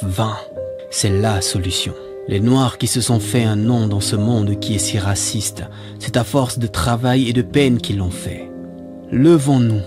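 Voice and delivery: ordinary delivery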